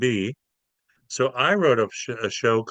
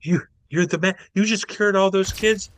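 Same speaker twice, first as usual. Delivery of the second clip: high, bubbly gushing voice, pitched well up; gushing reporter; 8 semitones higher than usual. Retold or imitated. Starstruck reporter gushing at Jesus, high and bubbly. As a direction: gush over him like a starstruck reporter.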